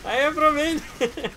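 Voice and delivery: high, slow, loud mock Romanian accent; drawn-out, hammed-up Romanian accent. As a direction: announce it in a terrible Romanian accent. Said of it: "My Romanian accent".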